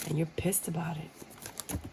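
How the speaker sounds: hushed confiding whisper. Acted. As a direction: let it slip out as a secret. Whispered aside.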